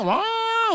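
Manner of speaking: high shouted siren "wow"; mock siren yell; siren wail. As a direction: cry out like an ambulance siren. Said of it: "pagka nagwangwang yan".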